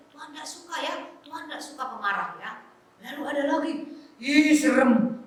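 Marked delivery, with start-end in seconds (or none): 4.2-5.2 long, loud playful shudder; exaggerated spooked squeal; mock fright. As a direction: play scared for the children, drawn out. Pretend fright at scary picture.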